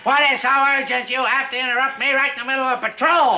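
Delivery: high, sharp, annoyed; irritated outburst. Acted. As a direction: snap at being interrupted. Interrupted mid-story, snaps.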